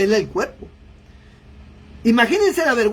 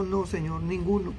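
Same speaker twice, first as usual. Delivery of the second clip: much softer, lower, timid answer; trembling, ashamed; 4 semitones lower, 9 dB quieter than usual. A narrator's reading. The ashamed woman answering the Lord, soft and timid. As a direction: barely dare to say it. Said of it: "Y ella, temblando, avergonzada".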